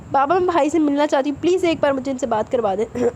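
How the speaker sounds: ordinary delivery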